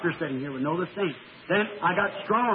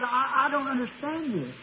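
high, slow and bewildered; lost, amazed stammer; 6 semitones higher than usual. Retold or imitated. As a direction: stammer it out in wonder. Bewildered in heavenly vision.

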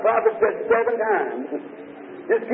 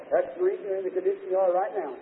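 ordinary talk, nothing marked

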